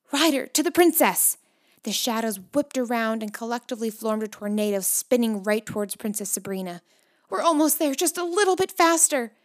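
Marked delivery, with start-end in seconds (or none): 0.1-1.4 high, loud heroic command; raised voice, charging into action; rallying call. A narrator's reading. Hero calls command to her mount. 7.3-9.3 high, loud and pressing; excited, raised-pitch cheering on; urgent urging. A narrator's reading. Hero urges rider on mid-chase.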